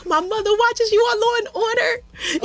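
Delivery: very high, almost crying fan gush; squealing fangirl voice; overexcited fangirl. Retold or imitated. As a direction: blurt it out like a starstruck fan near tears. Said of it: "so fangirl".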